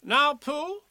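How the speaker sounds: high, loud officious voice; commanding rabbit. A narrator's reading. Rabbit taking over from the bear, high and commanding. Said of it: "it was time he took command".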